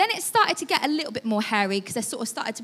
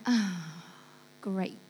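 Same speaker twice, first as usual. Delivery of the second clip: sarcastic; 5 semitones lower, 6 dB quieter than usual. Retold or imitated. Car stuck on icy road.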